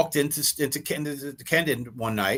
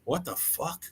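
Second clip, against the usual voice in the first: stunned mutter; 4 semitones higher, 9 dB quieter, slower than usual. Retold or imitated. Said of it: "I'm sitting there going".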